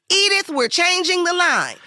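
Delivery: high, projected director imitation; director announcing. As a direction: call it out to the crew as director. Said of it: "He says".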